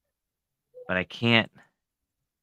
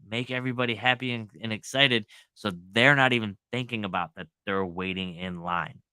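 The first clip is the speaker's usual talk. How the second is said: ordinary delivery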